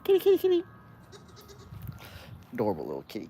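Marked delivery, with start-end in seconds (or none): 0.0-0.6 very high singsong call to the cat. Real, not acted. Calling the cat over in a very high voice. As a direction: coax the kitty with a sky-high sweet call.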